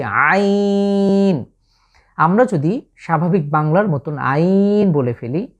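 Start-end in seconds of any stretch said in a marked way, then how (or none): 0.0-1.4 আইন pressed from the middle of the throat, higher and very long; throaty squeezed আইন held out; Arabic letter ain. Shown as a demonstration. Teacher showing viewers the throat letter, strained long আইন. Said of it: "ভেতর থেকে উচ্চারণের চেষ্টা করছি".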